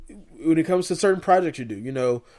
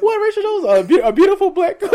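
laughing; 6 dB louder than usual. Real, not acted. Laughing outburst at the mix-up.

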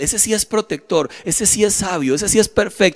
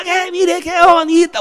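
high, slow mock yell; yelling inner temptation; 10 semitones higher than usual. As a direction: call out as a pestering inner voice, pitched way up. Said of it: "Una parte de mí me gritaba". (grito agudo de voz interior tentadora)

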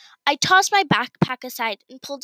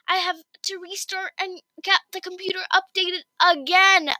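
higher-pitched, upset rush of words; mild wail; 8 semitones higher than usual. Acted. Emma telling mom her podcast was deleted, a mild higher wail. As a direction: plead in distress. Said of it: "I wailed".

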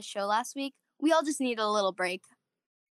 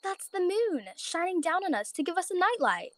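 gentle bunny mother; 7 semitones higher than usual. Acted. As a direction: explain it tenderly to a child. Mother voiced.

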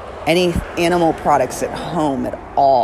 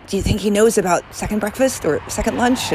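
lightly voiced hobbit, quick and high; a hint of a chatty hobbit; 7 semitones higher, faster than usual. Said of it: "the hobbits always talk about".

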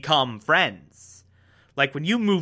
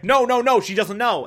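higher, hurried mock denial; protective denial; 7 semitones higher, faster than usual. Parents protecting Paige from Stan, higher and hurried. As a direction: rush to shield her.

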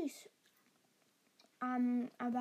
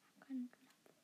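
whispering; 10 dB quieter, faster than usual. Real, not acted.

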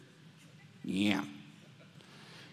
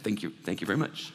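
quiet, low, smug thank-you; softer and lower, pleased with yourself; mock self-satisfied; 8 semitones lower, 7 dB quieter than usual. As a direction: play it as a humble-brag. Mock-proud reply to praise.

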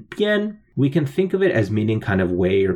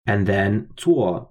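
usual speaking voice

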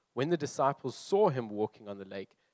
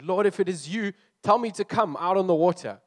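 higher, louder eager plea of Peter; Peter pleading; 7 semitones higher, 9 dB louder than usual. Acted. Peter crying to Jesus from the boat, higher and louder. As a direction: call out boldly to Jesus. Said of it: "He said".

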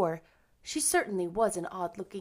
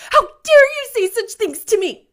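high, loud indignant outburst; vehement; 14 semitones higher, 16 dB louder than usual. A narrator's reading. Anne confronts Missus Rachel, vehement. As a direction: demand it in a child's fury. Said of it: "she repeated vehemently".

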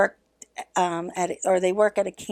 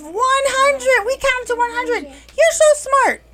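excited praise; 14 semitones higher, 5 dB louder than usual. Real, not acted. A teacher praising five-year-olds for counting to a hundred, high excited gush. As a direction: cheer them on like a proud teacher.

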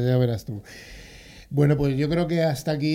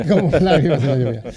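laughing; 6 dB louder, faster than usual. Real, not acted.

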